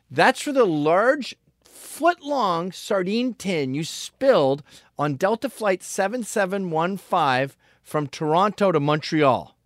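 high, loud and slow, over-precise; pompous revenge speech. Pretend. Spelling out the sardine grudge to the stranger, slow and loud. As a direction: savour every detail of the grievance. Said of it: "get out of the car and go".

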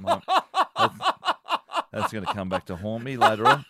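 talking through laughter; laughing. Real, not acted. Radio host cracking up over screaming eagle, laughing. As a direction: laugh while getting the words out.

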